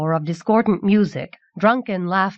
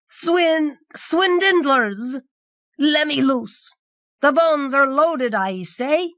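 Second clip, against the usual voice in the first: high-pitched outraged cries; drunken screaming; 7 semitones higher, slower than usual. A narrator's reading. Cheated patron to the men throwing him out, screaming high. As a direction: protest in a high voice, stumbling on the first word. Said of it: "he screamed".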